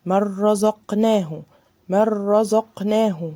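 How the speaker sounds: من رزقناه slow, straight into a doubled ra; careful tajweed chanting; slow recitation. Shown as a demonstration. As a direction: recite من رزقناه slowly with no ghunna. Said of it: "ما بينتش النون خالص".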